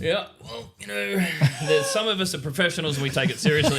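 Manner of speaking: high, laughing through the words. Real, not acted. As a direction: laugh while protesting. Laughing at garage shade.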